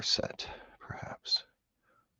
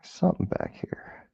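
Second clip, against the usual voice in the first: slow, voiceless whisper; 4 semitones higher, 6 dB quieter than usual. Real, not acted. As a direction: murmur the find under your breath. Whispering while picking lock.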